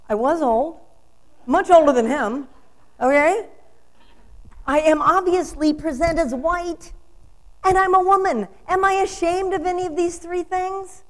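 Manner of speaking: loud, high, ringing declarations; full-force preaching. Acted. Minister to congregation, defiant and loud. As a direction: preach it to the back row.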